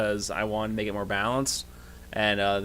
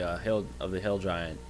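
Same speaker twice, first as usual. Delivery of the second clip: usual speaking voice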